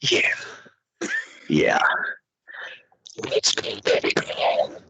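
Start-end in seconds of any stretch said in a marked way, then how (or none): 3.3-4.8 voiceless whisper into the ear; breathy whisper. Shown as a demonstration. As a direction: whisper it too close and too intimate. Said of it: "it's too erotic in my ear".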